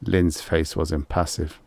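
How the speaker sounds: ordinary delivery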